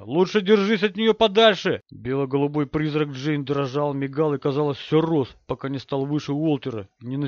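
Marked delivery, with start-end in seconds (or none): 0.0-1.8 loud, higher-pitched warning; shouted warning. A narrator's reading. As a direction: shout to keep them away from danger. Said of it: "закричал я". (кричит, предостерегая)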